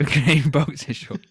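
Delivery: slow, laughing through the words. Real, not acted. Co-host laughs at his own bedroom joke, slowly.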